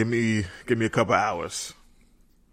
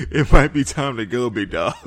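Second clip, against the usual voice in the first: loud punchline; 2 semitones higher, 8 dB louder than usual. Acted. Punchline telling someone to leave.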